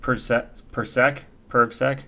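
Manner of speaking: PrivSec slurred to "purr-sek", then "purv-sek"; trying out pronunciations. Shown as a demonstration. Streamer sounding out a name, PrivSec as "purv-sek". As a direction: test Persec and PrivSec as questions with an "er" vowel. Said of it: "Is it PrivSec or PrivSec?".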